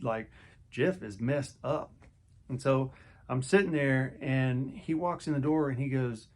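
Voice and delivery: ordinary delivery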